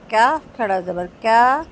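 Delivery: usual speaking voice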